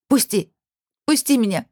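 high, urgent cry; desperate plea. A narrator's reading. Woman struggling in her ex's grip, desperate high plea. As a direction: cry out to be released.

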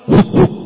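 high loud barking; dog bark. Retold or imitated. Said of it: "Kalau sama".

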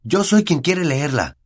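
put-on high, slow, insistent boy's voice; boy enraged over his letter; angry. A narrator's reading. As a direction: insist furiously on your claim. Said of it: "dijo Harry con rabia".

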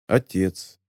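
low, understated bitterness; bitter thought. A narrator's reading. Charlie thinking of his unknown father, quietly bitter. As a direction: let the word drop low with a touch of bitterness. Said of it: "с горечью подумал Чарли".

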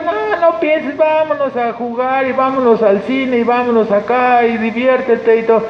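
high, coaxing mock voice; tempting friend. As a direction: lure him out to have fun.

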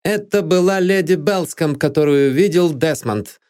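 mildly exultant revelation; triumphant. A narrator's reading. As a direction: declare it as a proven point. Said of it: "торжествующе вскричал Норвуд".

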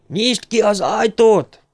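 high, drawn-out desperate shout; full-throated yell. A narrator's reading. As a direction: yell it slowly through a closed door. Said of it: "üvöltötte vissza torka szakadtából". (torkaszakadtából, elnyújtva üvöltve)

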